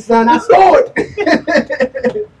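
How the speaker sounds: loud, high reenacted hollering; excited bragging. Running back to his cousin's block, loud high bragging. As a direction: call out rapid-fire to a buddy, elated.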